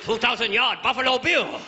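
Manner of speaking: high-pitched mock Japanese accent; exaggerated Japanese-accented admiration; comic Japanese caricature. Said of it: "All the way to Japan".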